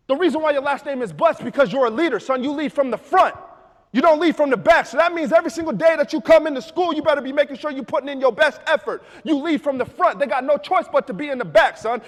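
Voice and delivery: loud, high rousing sermon of a father; father roaring motivation at his son; preaching dad. Retold or imitated. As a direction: hype him up like a preacher. Dad's loud motivational speech.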